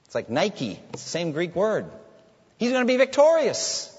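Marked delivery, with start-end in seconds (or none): 2.6-3.9 loud, high, ringing declaration; triumphant proclamation. Real, not acted. Preacher declares Christ's final victory to the congregation, loud and triumphant. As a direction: proclaim victory with full conviction.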